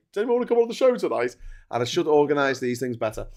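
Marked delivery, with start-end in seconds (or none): 0.1-1.4 imitating himself. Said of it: "I did leave it to the last minute to say".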